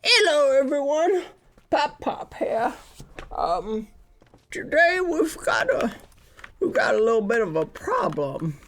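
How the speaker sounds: put-on high, cranky old grandpa voice; grumpy old grandpa defending his lawn; Pop Pop voice. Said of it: "I miss the Pop Pop voice".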